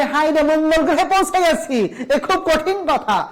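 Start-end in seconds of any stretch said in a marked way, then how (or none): 0.0-1.8 higher-pitched, mildly exclaimed dismay; mock dismay. Teacher voicing a student's first look, mock dismay. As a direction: lightly act out a bewildered first reaction. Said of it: "প্রথম দেখা মাত্র মনে হবে".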